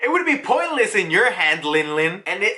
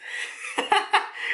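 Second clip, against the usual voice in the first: breathy silent laugh; 12 semitones higher, 6 dB quieter than usual. Real, not acted. Laughing at Kuma scene.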